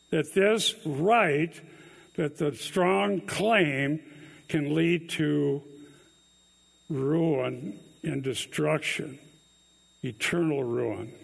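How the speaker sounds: each word slowed and pressed; deliberate solemn sermon stress; slow, weighty emphasis. Acted. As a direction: spell out the warning slowly, with gravity.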